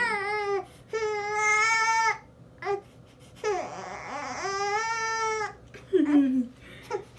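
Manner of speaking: high, loud laugh mixed with crying; teary laugh. Real, not acted. Laughing through tears at crying baby.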